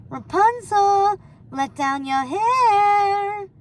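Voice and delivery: high, slow sing-song call. A narrator's reading. Gothel calling up to the tower, sing-song.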